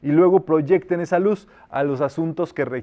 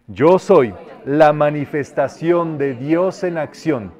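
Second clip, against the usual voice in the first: slow, emphatic affirmation; solemn decree; 5 dB louder, slower than usual. Shown as a demonstration. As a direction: proclaim it slowly, like a vow. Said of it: "Podemos decretar". (decreto solemne y pausado)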